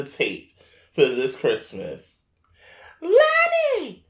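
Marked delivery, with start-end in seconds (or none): 3.0-4.0 high, hollered summons from mom; mother calling out. Retold or imitated. Mother calls him about the dog on the camcorder, loud shrill-pitched yell. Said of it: "My mother on the camcorder".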